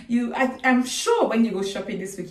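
ordinary delivery

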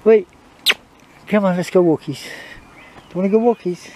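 high singsong coaxing to the dog; bright high "walkies" call. Real, not acted.

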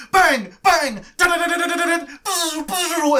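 loud, high make-believe gun noises; excited imitation of gunfire; mimed gunfire. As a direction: play at shooting, bangs into machine-gun rattle. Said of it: "spend the next hour just like".

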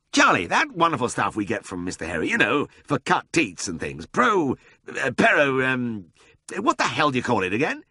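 high-pitched, fumbling lord; flustered lordly searching for a word; aristocrat groping for word. A narrator's reading. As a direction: stumble and hesitate over the name, then burst out.

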